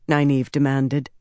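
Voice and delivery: ordinary delivery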